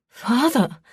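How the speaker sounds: appalled outburst; shocked protest. A narrator's reading. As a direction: exclaim in shock at the accusation. Accused daughter cries out in protest.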